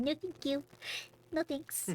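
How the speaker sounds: lightly voiced grass refusing politely; a hint of high prim little voice; voicing the grass.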